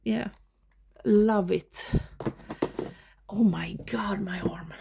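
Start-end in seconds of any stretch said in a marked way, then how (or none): 3.3-4.7 whispering. Real, not acted. Sore arm while filming.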